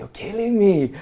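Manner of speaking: high-pitched, incredulous protest; retold disbelief. Swimmer answering the captain's bad news, incredulous.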